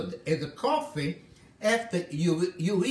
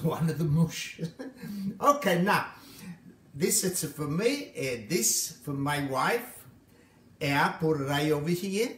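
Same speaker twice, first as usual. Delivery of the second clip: ordinary delivery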